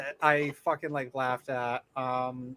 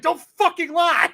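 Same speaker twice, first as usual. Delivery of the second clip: high, loud scolding; angry retort; 11 semitones higher, 6 dB louder than usual. Retold or imitated. Telling a liar off, loud and high. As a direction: snap it out in disbelief. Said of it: "I'm just like".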